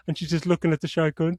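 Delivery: normal talking voice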